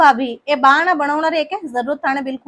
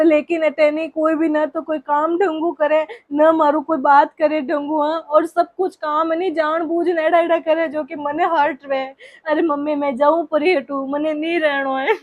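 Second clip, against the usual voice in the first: usual speaking voice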